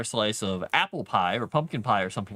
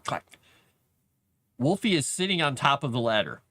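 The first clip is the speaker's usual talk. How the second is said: ordinary delivery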